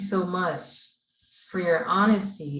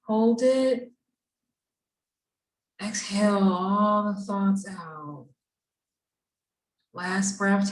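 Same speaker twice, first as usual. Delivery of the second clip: guided breathing. Acted. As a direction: lead the class gently through each breath. Leads class breathing exercise.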